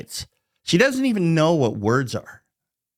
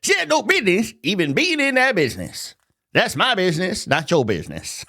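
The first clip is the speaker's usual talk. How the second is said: higher, sassy mock scolding; 6 semitones higher than usual. Shown as a demonstration. Ranting about AOC, redoes the line as a sassy scold. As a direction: play up a put-out sassy scold.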